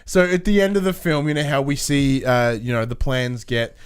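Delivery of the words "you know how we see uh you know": ordinary delivery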